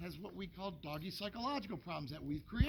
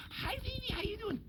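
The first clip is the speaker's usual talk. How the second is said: put-on much higher cooing baby talk; baby talk to the dog. Owner back home greeting the dog, high cooing baby talk. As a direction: coo it up high like greeting a puppy. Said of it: "right back to".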